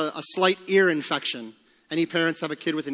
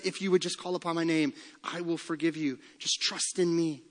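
gentle voice of Christ; 7 dB quieter than usual. Acted. Christ voiced speaking to sinner.

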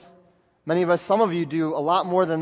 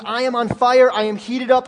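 louder and higher, ablaze; fiery preaching; 4 semitones higher, 5 dB louder than usual. Acted. Preacher voicing Paul on fire to listeners, loud and fiery. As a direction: shout Paul's fire out. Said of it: "He says".

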